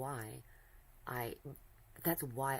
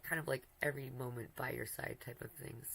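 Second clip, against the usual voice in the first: ordinary talk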